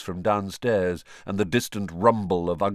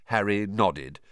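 plain reading voice

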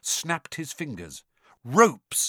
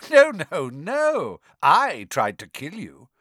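loud, triumphant villain; gleeful correction; 5 semitones higher, 6 dB louder, slower than usual. A narrator's reading. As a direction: relish the confession.

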